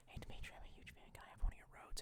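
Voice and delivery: hushed pretend fan whisper; whispered fan greeting. Streamer imagining asking Dimitri for a microphone, whispered. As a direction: whisper it like a shy request to an idol.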